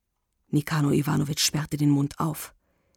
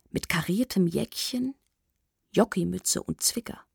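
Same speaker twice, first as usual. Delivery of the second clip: ordinary delivery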